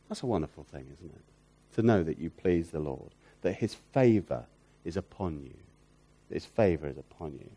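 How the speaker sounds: gentle hush over God's favour; hushed, tender. Real, not acted. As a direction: drop to a soft, near-whispered awe. Quiet tender reflection on God's favour.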